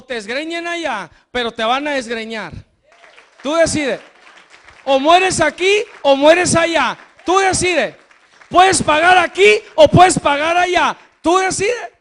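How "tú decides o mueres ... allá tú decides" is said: louder, building preacher's cry; predicación enérgica. Acted. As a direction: hammer each choice home to the crowd. Preaching at full force. (predicación a gritos, cada vez más fuerte)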